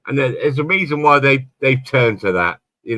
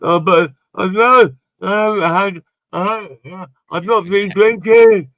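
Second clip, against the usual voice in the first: slurred protest; 6 semitones higher, 5 dB louder than usual. Retold or imitated. Imitating himself denying drinking to his wife, high and slurred. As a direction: slur it like you're wasted. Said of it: "Like that".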